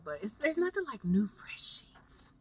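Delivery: breathy whispered aside about the new sheets. Real, not acted. To the viewers about new sheets, whispered. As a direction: drop to a secret whisper, savouring it.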